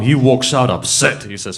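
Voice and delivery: ordinary talk, nothing marked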